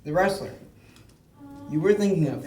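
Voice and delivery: talking with mouth open while chewing; mouth full. Real, not acted. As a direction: speak around a mouthful of crackers. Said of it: "I'm talking with my mouth open".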